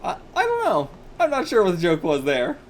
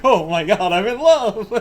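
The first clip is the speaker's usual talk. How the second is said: loud, laughing smitten gasp; smitten boy; 4 semitones higher, 8 dB louder than usual. Acted. Boy falling for the angry girl, loud laughing swoon. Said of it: "he's like".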